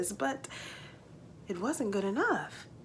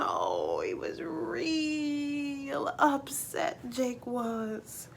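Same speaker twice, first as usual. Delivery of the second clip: slow, softer, tearful narration; crying voice; 5 semitones higher, 5 dB quieter, much slower than usual. A narrator's reading. Telling how upset Jake came back, slow tearful voice. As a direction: let the voice break as you tell it.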